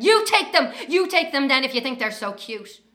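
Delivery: loud, high exasperated shout; the mother finally snapping at gushing strangers; fed-up mother yelling at admirers, loud and high; imagined yell. Retold or imitated. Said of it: "stopping herself from yelling".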